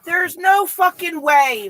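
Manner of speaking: loud, high, drawn-out disbelief; shouting. Real, not acted. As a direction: yell it out in shock. Gamer shocked at being sniped.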